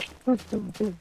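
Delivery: short high giggle. Real, not acted. Recounting the teacher's fall, short high giggle. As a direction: giggle quickly. Laughing at the story.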